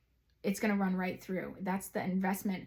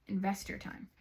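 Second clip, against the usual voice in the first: softer, understated piece of advice; quiet urging; 7 dB quieter than usual. Real, not acted. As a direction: drop the volume and say it simply.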